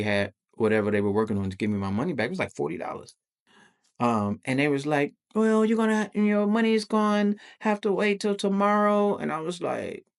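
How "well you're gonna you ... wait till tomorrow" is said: high-pitched mock employee; imitation of staff giving bad news; imitated staff voice. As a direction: put on a high customer-service voice. Voicing the restaurant staff.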